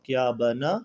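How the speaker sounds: slow drawn-out recitation of letters; spelling drill. Shown as a demonstration. Teacher slowly spelling Quran letters for students, drawn out. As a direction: chant each letter and vowel slowly.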